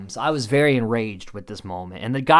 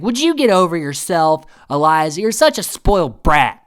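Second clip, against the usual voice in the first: raised, louder retort in a quarrel; acted-out irritated put-down, pitched up; mock scolding; 4 semitones higher, 5 dB louder than usual. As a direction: tell them off, imitating exasperation. Acted quarrel, Scout scolds Eliza.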